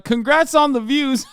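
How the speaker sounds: voicing YouTube congratulating him; mock YouTube praise. Streamer mocking YouTube, fawning corporate voice. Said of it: "YouTube was like".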